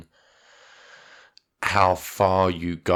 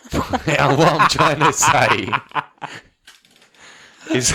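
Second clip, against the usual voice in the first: laughing; 7 semitones higher, 4 dB louder, much slower than usual. Real, not acted. Cracking up at own joke.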